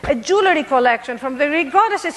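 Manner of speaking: normal talking voice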